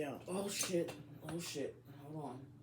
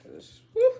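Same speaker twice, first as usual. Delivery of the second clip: loud whew; 16 dB louder than usual. Real, not acted. Exasperated exhale.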